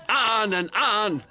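slow mocking shout. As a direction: drag it out to mock him. Mocking the other's bragging.

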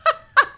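high, loud burst of laughter; incredulous, ringing laugh. A narrator's reading. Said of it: "brach in lautes Lachen aus".

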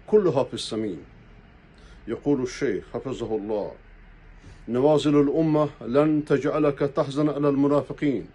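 usual speaking voice

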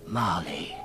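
normal talking voice

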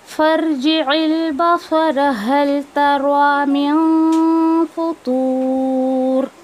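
slow, drawn-out melodic recitation; Quran recitation. Shown as a demonstration. Teacher recites the third verse for the class. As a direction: chant the verse, stretching every vowel.

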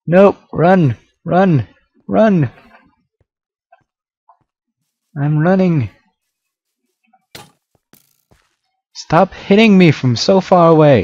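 high panicked yelling; yelling while running away. Real, not acted. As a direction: yell it while fleeing. Fleeing attacker in game.

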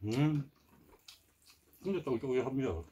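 chewing while talking; muffled through food; mouth full. Real, not acted. Seen 1: Pursed, bulging lips chewing food just eaten; reference frames show an empty mouth.

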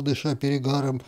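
plain reading voice

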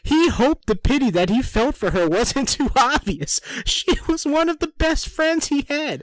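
high put-on voice, breaking into laughs; hammed-up reading with giggles; mock dramatic reading. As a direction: parody the read-along in a squeaky voice.

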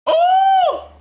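very high loud shout; excited yelp. Real, not acted. Fan yelping as the fight starts. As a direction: yelp at the first hit.

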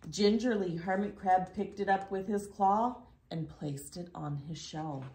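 usual speaking voice

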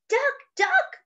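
high-pitched storybook cry. Acted. Hippo calls to Duck in the leaf pile, crying out. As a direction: call out to a friend in surprise. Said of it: "cried Hippo".

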